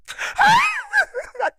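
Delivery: very high-pitched hammed-up wail; mock groupie sobbing. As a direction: bawl like a starstruck groupie. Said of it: "crying like a little groupie bitch".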